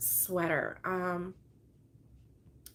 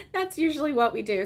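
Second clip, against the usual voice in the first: usual speaking voice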